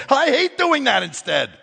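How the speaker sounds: a hint of a whiny complainer; mock whine. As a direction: play the sulky child. Whiny congregant voice.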